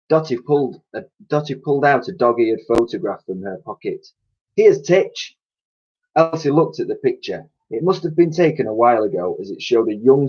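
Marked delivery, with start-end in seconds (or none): 4.6-5.4 cleaning lady voice. A narrator's reading.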